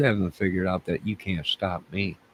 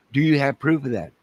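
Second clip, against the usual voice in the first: higher, louder questioning as a detective; playing the interviewer questioning himself, higher and louder; mock interrogator; 6 semitones higher, 4 dB louder than usual. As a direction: grill yourself like a cop. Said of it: "I'm gonna interview myself".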